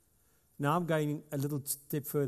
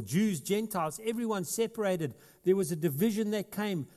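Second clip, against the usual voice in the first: fervent pulpit delivery; preaching at full force; 6 semitones higher than usual. Acted. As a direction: proclaim it over the congregation. Preaching Paul's words, raised.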